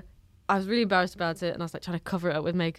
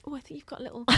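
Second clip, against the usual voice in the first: high, very soft tentative murmur; hint at it delicately, barely voiced; British politeness; 8 semitones higher, 12 dB quieter, much faster than usual. British person tiptoeing around a burning face, softly hesitant. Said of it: "As a British person".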